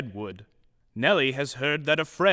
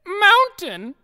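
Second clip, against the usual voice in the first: very high squeaky mountain; sneezy dwarf voice; 7 dB louder than usual. A narrator's reading. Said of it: "Sneezy exclaims".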